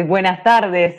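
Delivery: ordinary delivery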